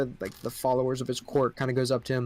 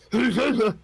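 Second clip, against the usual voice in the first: creature cackle; 9 semitones higher, 5 dB louder than usual. Acted. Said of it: "he grabs your horses and he goes".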